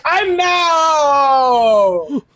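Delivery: drawn-out mock cry; long teasing wail; imitated reaction. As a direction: mimic his reaction, stretching the last word. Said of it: "He's like".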